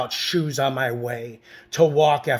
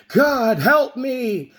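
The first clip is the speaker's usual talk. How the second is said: screaming for help; 10 semitones higher, much slower than usual. Retold or imitated. Man on the restaurant floor screaming to God for help. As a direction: wail it out like you're being beaten. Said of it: "And I started screaming".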